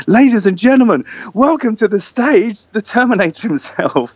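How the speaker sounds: high, booming stage introduction; event announcer. Retold or imitated. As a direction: announce the star to a packed crowd. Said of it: "the announcement went".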